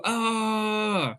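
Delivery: high joyful cry of triumph; happy shriek; excited scream. Retold or imitated. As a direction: let out a thrilled yell. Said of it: "this dream has come true".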